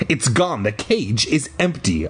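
plain reading voice

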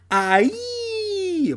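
long high-pitched falling scream; drawn-out squealing "aaiee"; yelp of falling. Acted. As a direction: scream as you tumble off. Falling off the bike.

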